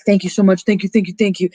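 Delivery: repeated thanks tumbling out, lightly mocked; imitated gushing thanks. Stu is let go by her, mock gushing thanks. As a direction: play a relieved man thanking over and over.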